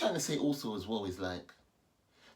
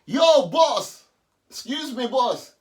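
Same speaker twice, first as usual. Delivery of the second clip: loud calling shout, higher; screaming; 11 semitones higher, 13 dB louder, slower than usual. Real, not acted. Shouting after the man who gave him money. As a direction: yell after him down the street. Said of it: "I started screaming there".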